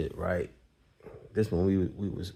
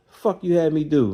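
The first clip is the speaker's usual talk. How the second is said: higher, louder accusing outburst; mock confrontation; 8 semitones higher, 6 dB louder than usual. Narrator confronts friend Hammer, higher mock outburst. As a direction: call him out, half-joking.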